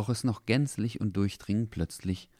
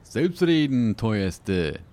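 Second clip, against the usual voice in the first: sonorous man's voice; 4 semitones higher, 6 dB louder, much slower than usual. Acted. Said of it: "eine sonore Männerstimme".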